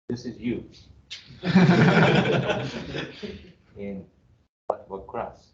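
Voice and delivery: ordinary delivery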